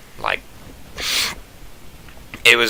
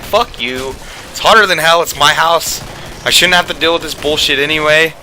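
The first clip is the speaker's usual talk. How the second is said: higher and louder mock rant; fed-up yelling at the houseguest; retold angry outburst; 6 semitones higher, 3 dB louder than usual. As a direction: relive the blow-up at full tilt. Retold blow-up over the thermostat.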